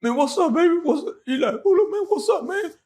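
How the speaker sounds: very high, loud mock angry shouting; big homeboy mad. The big homeboy demanding to know what happened, mock furious shouts. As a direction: bark it like a huge angry friend. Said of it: "He mad".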